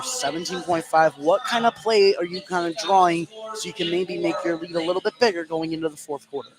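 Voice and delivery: ordinary talk, nothing marked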